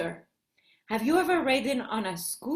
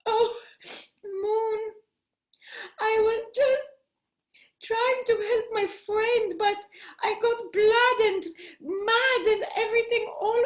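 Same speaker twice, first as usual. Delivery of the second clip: put-on high, whimpering child voice; through tears; 6 semitones higher than usual. Hannah explains the ruined dress to the moon, acted tearful voice. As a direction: plead tearfully, halting at first, then tumbling on. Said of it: "Hannah says through her tears".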